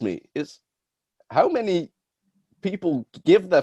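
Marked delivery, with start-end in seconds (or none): none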